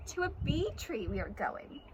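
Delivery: ordinary delivery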